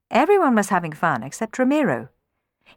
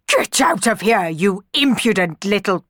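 higher, louder furious scolding; angry outburst; 4 semitones higher, 4 dB louder than usual. A narrator's reading. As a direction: round on the girl and order her out. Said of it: "Don Magnifico rounded on her".

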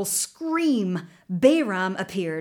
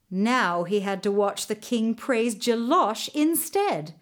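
ordinary delivery, nothing marked

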